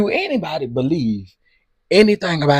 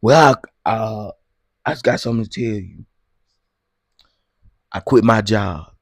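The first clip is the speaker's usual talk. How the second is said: Glenn voice; 2 semitones lower, 4 dB louder than usual. Retold or imitated. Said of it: "Here go Glenn".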